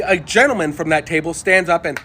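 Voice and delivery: ordinary delivery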